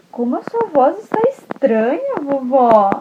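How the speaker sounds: put-on high, wondering child voice; curious little girl. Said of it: "a Chapeuzinho falou assim".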